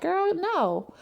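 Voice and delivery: put-on high sassy "girl, no"; mock scolding. Trina telling Toni off in a high voice. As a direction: shut it down, pitched high. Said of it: "Trina was reminding her, like".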